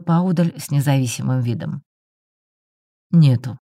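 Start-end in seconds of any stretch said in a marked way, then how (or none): 3.1-3.6 short, abrupt and louder; curt. A narrator's reading. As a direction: snap it off in one word. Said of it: "отрывисто сказал Ларион". (отрывисто, резко)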